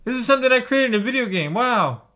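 high, rushed mock whine of a complaining fan; whiny complainer mock. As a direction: sneer it like a whining fan. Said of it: "they bitch, moan, and complain".

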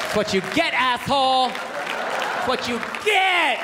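high-pitched taunt, stretched at the end; hammed-up spiteful mocking; gloating girlfriend. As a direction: jeer it, drawing the last word way out. Gloating girlfriend after stud punch.

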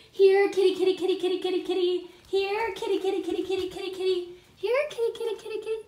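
high sing-song here kitty call; calling a cat. Real, not acted. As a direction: call the cats in, high and bright. Calling cats to birthday food.

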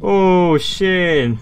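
loud high frightened curse; jolted by a scare; scared shout. Real, not acted. Fright after ghost event.

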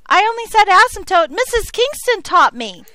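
loud, high mock student protest. Teacher imitating a student blaming her to the principal, loud protest.

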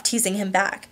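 ordinary delivery

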